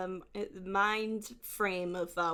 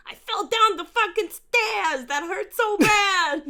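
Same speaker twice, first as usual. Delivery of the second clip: put-on much higher, louder, slow Boston accent; old Boston accent; 10 semitones higher, 7 dB louder, slower than usual. Friend Pat's old videos, whiny thick Boston accent. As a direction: moan about the stairs in a heavy Boston accent. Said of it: "He's gotten rid of his accent".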